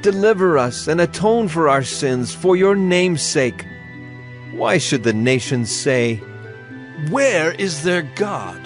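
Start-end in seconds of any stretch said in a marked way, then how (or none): none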